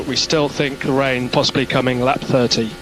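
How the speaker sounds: ordinary talk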